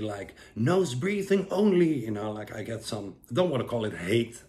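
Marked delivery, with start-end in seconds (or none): 0.5-2.0 high, loud, slow preachy mock of a commenter; mocking a viewer who insists on nose breathing; mock critic. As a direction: parody a know-it-all viewer. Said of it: "some of you will say".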